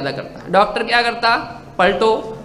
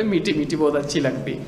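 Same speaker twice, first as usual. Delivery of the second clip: lower, softer, coaxing patient voice; mock a spoiled patient craving sweets; childish patient; 5 semitones lower, 6 dB quieter than usual. Patient telling the doctor what he likes, soft and childish.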